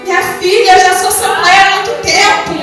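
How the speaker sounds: loud, high, impassioned preaching. Real, not acted. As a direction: build to a shouted climax. Preaches at full force, climaxing.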